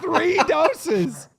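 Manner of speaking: high, squeaky laughing voice; real helpless laughter. Real, not acted. Storyteller cracking up over the doses, laughing as he speaks. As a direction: squeeze the words out through laughter.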